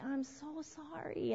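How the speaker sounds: soft, drawn-out apology; slow and gentle, sorry for the child; tender, lingering commiseration; sympathetic apology. Acted. Models sympathetic reply to upset child.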